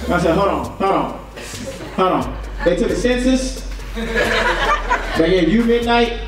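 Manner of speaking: normal talking voice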